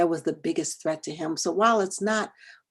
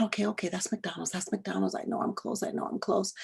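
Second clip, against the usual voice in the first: much softer, near-whispered reassurance to herself; anxious self-talk; 9 dB quieter than usual. Retold or imitated. Lost driver talking herself down at McDonald's, hushed. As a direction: murmur to keep yourself from panicking.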